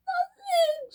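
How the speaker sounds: very high, squeaky nothing; squeaky girl engine. Acted. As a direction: squeak it out evasively. Caitlin squeaks an answer.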